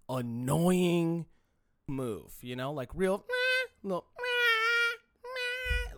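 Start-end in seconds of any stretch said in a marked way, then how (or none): 3.3-3.9 loud squeaky "meh"; high mocking bleat. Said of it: "making their voice go higher". 4.2-6.0 drawn-out, squeaky "meh"; high mocking bleat. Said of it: "making their voice go higher".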